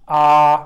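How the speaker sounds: आ held long, loud and level; long open AA. Shown as a demonstration. Teacher showing the sound this final makes, long loud आ. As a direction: sustain आ as an open "aah". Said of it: "आवाज़ वही निकलेगी इसकी".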